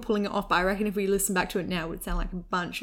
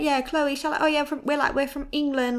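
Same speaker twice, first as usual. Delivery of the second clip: higher, hammed-up Essex accent; fake Essex accent; 7 semitones higher, 3 dB louder than usual. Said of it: "we had this accent".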